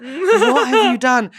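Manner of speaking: slow, stretched question with laughter; hammed-up, fully voiced reproach; mock appalled. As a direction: imitate an appalled colleague, amused. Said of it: "but being like".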